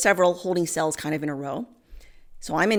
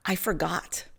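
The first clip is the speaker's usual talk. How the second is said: sheepish confession; 6 dB quieter than usual. Retold or imitated. Sheepish answer to the judge.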